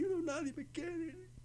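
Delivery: high, soft, slow moping whine; self-pitying moan. Retold or imitated. Pastor mimicking a depressed woman in the dark, whiny. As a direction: drag it out, sorry for yourself. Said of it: "y está ahí".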